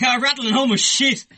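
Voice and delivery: high, loud nonsense blurt; flustered blurt. Retold or imitated. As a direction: blurt it out and hang up. Blurted line to [name] on phone.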